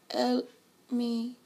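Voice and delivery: high Vietnamese-accented imitation; imitating Vietnamese man. Quoting the Vietnamese man's catchphrase in his Vietnamese accent. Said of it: "it's exactly how he said it".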